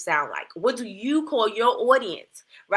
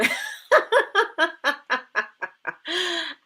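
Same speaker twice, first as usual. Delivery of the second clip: high-pitched long laugh; real giggling fit; 7 semitones higher than usual. Real, not acted. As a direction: crack up at your own remark. Laughs at own remark.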